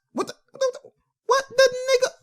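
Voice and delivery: very high, softened squeak of disbelief; stunned disbelief. Real, not acted. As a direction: sputter in a high voice. Stunned by the refereeing.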